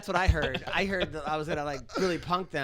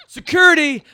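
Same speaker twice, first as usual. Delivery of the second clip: very loud, high yell; retold call for security; 10 semitones higher, 18 dB louder than usual. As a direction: shout for the guards across the room. Casting people call for security.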